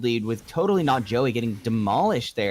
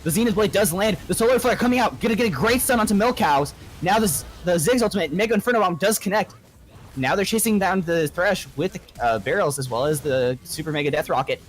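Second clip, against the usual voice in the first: live play-by-play; 4 semitones higher than usual. Real, not acted. Caster calling the teamfight for viewers, excited play-by-play. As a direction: call the teamfight live, building hype.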